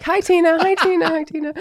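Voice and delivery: string of high chirpy greetings; chorus of fans. Retold or imitated. Host mimicking listeners greeting her. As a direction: pile up the "Hi, Tina"s in a high voice. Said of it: "So then there's people like".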